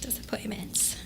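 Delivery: whispered aside. Real, not acted. Wrapping up the sad story, a whispered sign-off. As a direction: breathe the word out under the breath.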